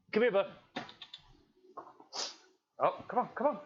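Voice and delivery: high eager coaxing to a pet; coaxing the dog. Real, not acted. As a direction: pitch it up to lure the dog in.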